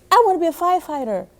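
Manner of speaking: put-on fast, high kid voice; eager kid blurting it out; child classmate. A narrator's reading. A classmate answering the teacher, fast and high. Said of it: "Someone said".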